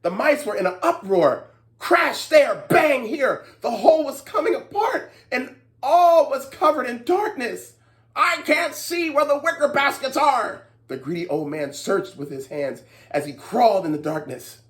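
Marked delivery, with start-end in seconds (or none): 1.8-7.7 high lively telling; excited narration. A narrator's reading. Storyteller describing the mice's uproar, excited. 8.1-10.6 high worried voice; fretful old man. Acted. Greedy old man searching blindly, fretting.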